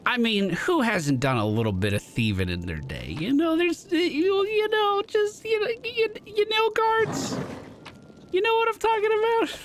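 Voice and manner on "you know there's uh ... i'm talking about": high squeaky hedging. Acted. Player's character squeaks, hedging.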